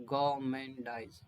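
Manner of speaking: गाउमेंडाइज said slowly with no r sound. Shown as a demonstration. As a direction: stretch गाउमेंडाइज out and leave the r silent. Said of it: "आर नहीं बोलेंगे". (गाउमेंडाइज धीरे-धीरे, बिना र की आवाज़ के)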